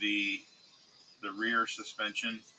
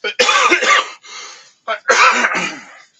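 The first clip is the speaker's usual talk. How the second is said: harsh, hacking cough; 12 semitones higher, 20 dB louder than usual. Real, not acted. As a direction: cough hard into the mic. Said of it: "Sorry to cough in the mic here".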